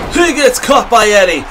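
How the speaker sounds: ordinary talk, nothing marked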